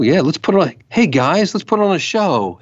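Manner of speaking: a cheery show-biz rallying call that keeps climbing; rising show pitch. Pretend.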